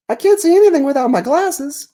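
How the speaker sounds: very high, sheepish catfish voice; cartoon catfish. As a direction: apologize in a squeaky critter voice. Catfish explains.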